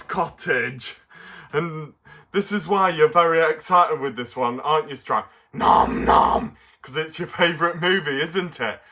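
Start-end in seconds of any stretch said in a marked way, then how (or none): 5.5-6.6 munching creature voice. Acted. Puppet Stripe answers.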